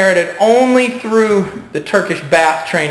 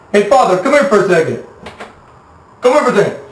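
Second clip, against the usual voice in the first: calling out; 11 semitones higher, 3 dB louder than usual. Pretend. Luring someone for ambush.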